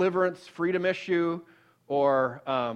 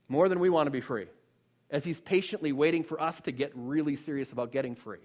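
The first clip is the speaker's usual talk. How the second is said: usual speaking voice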